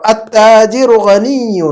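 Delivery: very slow, drawn-out Arabic recitation; model reading. Shown as a demonstration. Teacher reading a sentence for translation, very slow model reading. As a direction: read it out measured, stretching long vowels. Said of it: "جملہ پڑھتا ہوں".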